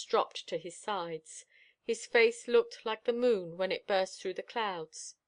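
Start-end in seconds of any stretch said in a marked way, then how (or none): none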